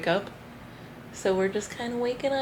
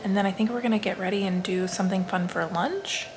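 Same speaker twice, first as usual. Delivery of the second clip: ordinary talk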